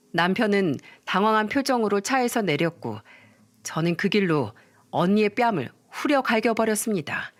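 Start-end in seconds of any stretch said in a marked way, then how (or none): none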